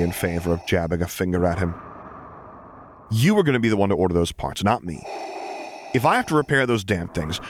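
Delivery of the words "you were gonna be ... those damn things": higher, insistent and scolding; scolding Luke. A narrator's reading. Luke sets terms for Vader about the repair parts. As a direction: lay down the law, rising to the end.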